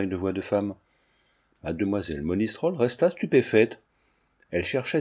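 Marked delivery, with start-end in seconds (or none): none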